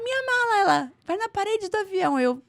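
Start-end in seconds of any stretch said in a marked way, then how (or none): 0.0-0.6 high-pitched retold wail; acted alarmed protest. Traveller to gate agent about her bag, high acted alarm. 1.0-2.2 high quoted command; imitated urgent order. Gate agent sending her off to the plane, high urgent order.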